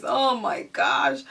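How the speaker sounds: ordinary talk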